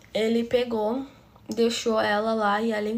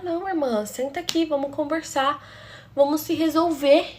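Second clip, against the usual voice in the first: higher-pitched mock conciliation; mimicked stepsister; 5 semitones higher, 5 dB louder than usual. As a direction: mimic her coaxing in a raised, singsong-like voice.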